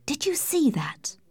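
high, hushed, whispery voice; alarmed hushed question. A narrator's reading. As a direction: ask it in a startled half-whisper. Laura spots someone watching them.

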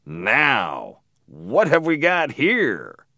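drawn-out, menacing growl of discovery; slow, menacing catch-you-out voice, rising high; menacing stranger. A narrator's reading. Man catching trespassing boys by the arm, menacing.

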